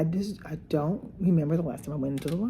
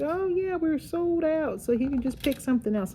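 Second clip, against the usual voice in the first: lightly voiced clerk; 10 semitones higher than usual.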